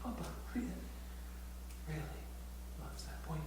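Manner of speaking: soft breathy whisper, each really stretched. Retold or imitated. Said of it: "the third time I say the same thing".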